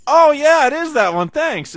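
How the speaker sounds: high, loud comic exclamation; hammed-up sudden discovery; acted delighted realization. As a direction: burst out with mock gratitude. Acting out sketch line after sniffing hands.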